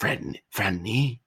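slow, drawn-out, strained calling of the name Franny; a retake that hams up the weak patient voice; second try at the impression. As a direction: stretch out Franny as if your throat is raw. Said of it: "that was the horrible impression".

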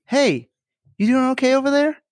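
high mock-concerned sing-song; playful check-in. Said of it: "where I would like to begin my segment".

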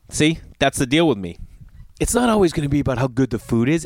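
ordinary talk

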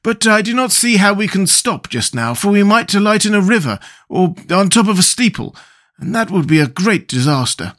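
a little uneasy. A narrator's reading. Scarecrow warns his friends against landing blindly, uneasy. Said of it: "replied a little uneasily".

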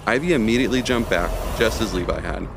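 ordinary delivery, nothing marked

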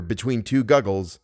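ordinary delivery

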